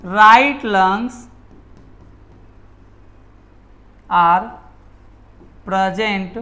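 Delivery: dictating slowly, word by word, with long pauses; slow dictation. Shown as a demonstration. Teacher dictating a note to students, very slow and spaced. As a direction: spell it out slowly so students can write it down. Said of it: "क्या लिखेंगे".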